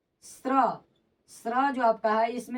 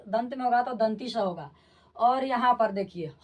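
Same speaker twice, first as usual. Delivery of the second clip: ordinary delivery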